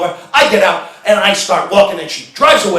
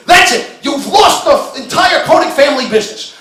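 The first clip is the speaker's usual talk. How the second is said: high, loud furious rant; shouting at the clerk while storming out of the store; angry shouting; 7 semitones higher, 4 dB louder than usual. Retold or imitated. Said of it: "storming out of the store and screaming".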